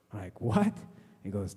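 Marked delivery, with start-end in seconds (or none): 0.4-0.8 very high, loud yelp of a question; shocked disbelief. Retold or imitated. Comic reacting to the piss cure claim, shocked disbelief. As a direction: burst out in comic astonishment.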